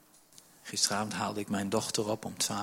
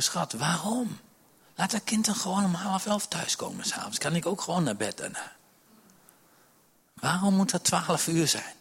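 mock complaint; 5 semitones higher than usual. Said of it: "hadden zelfs even woorden erover".

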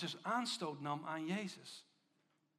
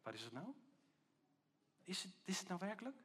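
soft, questioning voice of doubt; nagging inner voice; 6 dB quieter than usual. Acted. Little voice after the amens have faded, softly doubting. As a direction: ask it quietly, planting a doubt. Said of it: "dat zo'n stemmetje zegt".

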